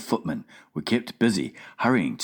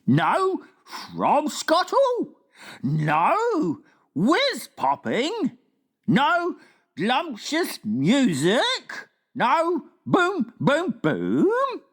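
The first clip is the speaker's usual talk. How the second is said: slow, higher giant's woeful disbelief; dismayed giant; much slower than usual. Acted. Giant mourning no fromscottle, woeful. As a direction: lament each lost joy.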